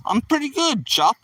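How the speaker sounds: high-pitched mock English accent; botched Australian, sounds English. Streamer answering a viewer, failed Australian accent coming out English. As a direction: aim for Aussie, land in chirpy English. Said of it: "No, that's English".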